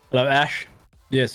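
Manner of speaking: ordinary delivery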